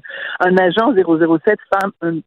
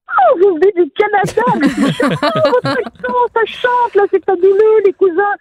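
very high-pitched mock Parisian gushing; 13 semitones higher than usual. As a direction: coo over a visiting cousin in a hammed-up, sky-high voice. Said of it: "J'arrive ici, là, à Paris". (imitation de Parisiens qui s'extasient, voix très haut perchée)

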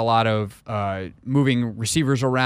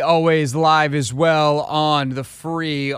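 radio host opener; 4 semitones higher, 4 dB louder than usual. Acted. Radio show open, announcer voice.

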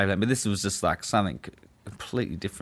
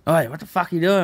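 raised, higher mock telling-off; imitated angry guard; 7 semitones higher, 6 dB louder than usual. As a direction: imitate a guard catching someone out. Retelling guard barking at him.

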